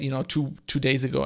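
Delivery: ordinary delivery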